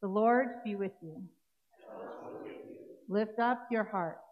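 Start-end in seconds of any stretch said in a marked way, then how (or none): none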